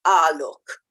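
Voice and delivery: low Australian drawl on "Ah, look"; lightly voiced Australian "Ah, look" said low and drawn out. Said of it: "she'll start everything with the word".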